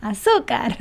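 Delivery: singer's famous catchphrase cry, playful. Acted. Teacher jokingly imitating a singer to co-host, playful cry.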